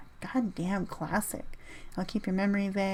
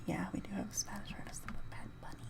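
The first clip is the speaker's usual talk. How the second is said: quick, much softer whisper; 11 dB quieter, faster than usual. Real, not acted. Vlogger sheepishly admits to his viewers he has Bad Bunny, whispered. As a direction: whisper it like a guilty aside.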